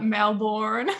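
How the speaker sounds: Melbourne with a fully sounded "born" ending; American pronunciation of Melbourne. Retold or imitated. Host echoes guest's accent, Melbourne said American-style. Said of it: "I love that Melbourne".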